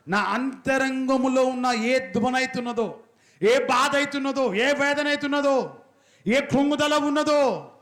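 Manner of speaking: high, slow, pleading prayer; fervent prayer. Acted. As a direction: stretch each line upward as a plea.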